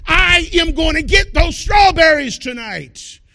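rising to a loud, determined shout; growing determination. Retold or imitated. Preacher acting out his fasting craving, ending in a loud, determined shout. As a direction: build from craving into a fierce vow to get them. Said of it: "desire comes into determination".

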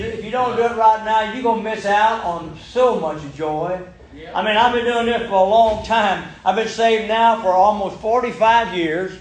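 usual speaking voice

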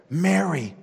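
Jesus calling Mary tenderly by name; a loving call. Acted. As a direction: call her name like someone she knows. Jesus calls her by name.

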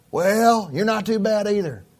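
put-on high, grudging old lady; trash lady's voice. Trash lady answering the pastor, high and grudging. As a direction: pitch it up like a gruff lady warming.

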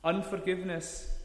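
usual speaking voice

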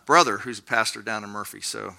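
ordinary talk, nothing marked

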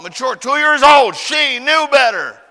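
loud, high, drawn-out protest; hammed-up self-righteous outburst; indignant child. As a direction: argue your case like an outraged six-year-old. Child indignantly blames toddler sister.

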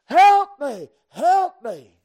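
loud, desperate acted yell; crying out. Trapped person in a car wreck, crying out. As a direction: shout for rescue at full voice. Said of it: "and they're crying".

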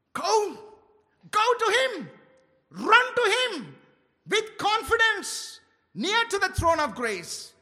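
high, loud, urgent call; rally the church to run forward; fervent preaching. Acted. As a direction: passionate preacher's plea. Urges congregation to come.

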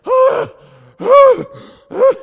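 high, loud groaning shout; mock exhausted yell. Youth group kids crying out, tired from pushing a ball. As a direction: cry out like kids worn out by a heavy ball. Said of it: "they're just like".

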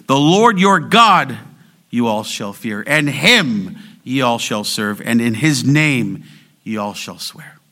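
slow recitation lifting "Lord your God", "him", "his name"; stress on front words. Shown as a demonstration. Preacher restates the verse for congregation, stressing "Lord your God", "him", "his name". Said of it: "Or said this way".

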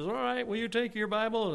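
lightly voiced, high-pitched visiting speaker; imitated missionary. Missionary asking the congregation to take their Bibles, lightly imitated. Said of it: "a missionary comes in and says".

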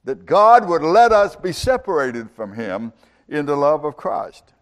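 mock cry of defiance, pitched high and easing at the end; imitated screaming challenge. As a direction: call it out to the whole universe, half-shouted. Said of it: "He's screaming out to the universe".

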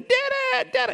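put-on high, loud excited toddler call; toddler calling daddy. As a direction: call out like an excited one-year-old. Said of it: "She's going".

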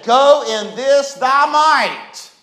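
drawn-out divine words; slow solemn command. Shown as a demonstration. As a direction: say it slowly as scripture. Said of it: "notice what he said".

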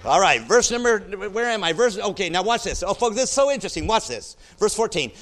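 loud, high, driving preacher's voice; full-force preaching. Real, not acted. Preacher rousing the church over the verse, loud and high.

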